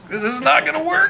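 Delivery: high, loud laughing cry; laughing, overwhelmed. Retold or imitated. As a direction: laugh it out, swept away by emotion. Overwhelmed, couldn't give speech.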